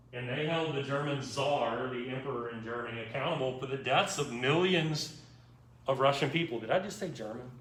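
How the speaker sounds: usual speaking voice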